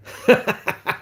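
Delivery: high burst of laughter; real laugh. Real, not acted. Streamer amused by the chat, real high laugh.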